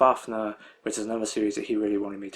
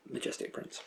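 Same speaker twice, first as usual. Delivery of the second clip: whispered; 13 dB quieter than usual. Shown as a demonstration. Title repeated under breath.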